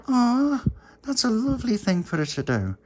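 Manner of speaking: high, cooing aw; touched high voice. Acted. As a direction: melt at the kind gesture, up high. Pauline touched.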